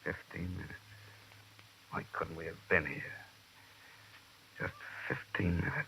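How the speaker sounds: soft, stricken murmur; stunned hush. Acted. Shaken detective learns the girl died minutes ago. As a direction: barely breathe it out.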